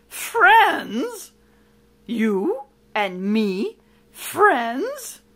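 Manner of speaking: loud, swooping disbelief; incredulous cry. Acted. Villain astonished by friendship offer, incredulous. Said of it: "cried Uriah the Heap".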